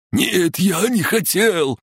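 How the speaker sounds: very high, slow wail; wailing sob. A narrator's reading. Sapper breaking down in the fight, wailing. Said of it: "зарыдал сапёр".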